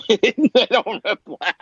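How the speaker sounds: slow, high words through laughter; laughing. Real, not acted. Telling his friend the owner ignored him, laughing. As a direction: laugh it out in disbelief.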